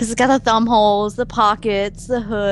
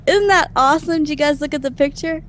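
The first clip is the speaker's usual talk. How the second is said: normal talking voice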